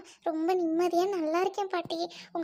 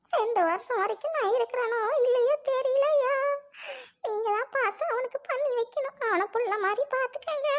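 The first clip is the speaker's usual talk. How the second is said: high, plaintive grandmother's lament; plaintive plea; 5 semitones higher than usual. Acted. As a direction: entrust him as if time is short. Grandmother fears she may die soon. (உருக்கமான கெஞ்சல்)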